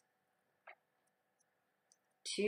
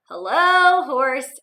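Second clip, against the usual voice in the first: cheerful duck greeting; 3 semitones higher, 9 dB louder, much slower than usual. Acted. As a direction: greet the horse brightly.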